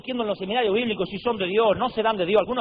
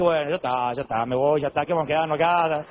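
resigned giving up; 5 semitones lower than usual. Retold or imitated. Resigned reaction to the story.

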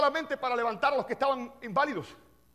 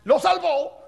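Lo salvó, said loud and higher, punched out; loud emphatic repeat; 4 semitones higher, 7 dB louder, much slower than usual. Shown as a demonstration. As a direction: hit "Lo salvó" hard and loud. ("Lo salvó" dicho fuerte y más agudo)

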